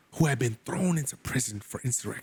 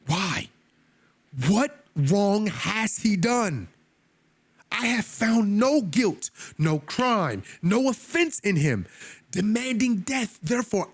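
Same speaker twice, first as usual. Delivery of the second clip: a higher, slowed plea as Pilate; Pilate pleading; 8 semitones higher, slower than usual. Retold or imitated. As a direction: make Pilate beg the crowd to see reason. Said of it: "A third time he said to them".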